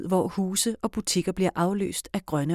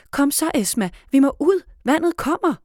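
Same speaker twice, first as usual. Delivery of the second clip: loud, high hurrying call; urgent shout; 9 semitones higher, 6 dB louder than usual. A narrator's reading. Liv hurrying Esma, urgent shout. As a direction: yell for her friend to hurry. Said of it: "råber Liv".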